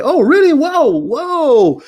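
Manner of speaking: a delighted jolt of surprise at the news; surprised. Retold or imitated. Father announces move to Africa.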